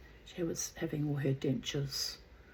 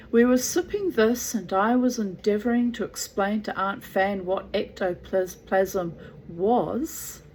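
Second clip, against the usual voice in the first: louder, animated storybook reading with swooping pitch; theatrical story narration; 3 semitones higher, 6 dB louder than usual. A narrator's reading. Gerald's account of the hotel visit, animated story narration. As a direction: read it out with dramatic flourish.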